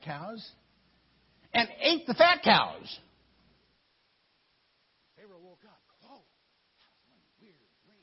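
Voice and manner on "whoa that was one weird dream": barely audible, retold waking reaction; very soft mock amazement; hushed amazement. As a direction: murmur it like someone just waking from a dream. Retold Pharaoh waking from dream.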